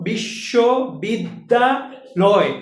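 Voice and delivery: বিশ্ববিদ্যালয় stretched very slowly, each syllable apart. Shown as a demonstration. Teacher slowly sounding out a long word for students, syllable by syllable. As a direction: drag out বিশ্ববিদ্যালয় to count its beats. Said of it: "কয়েকবার উচ্চারণ করা লাগে না".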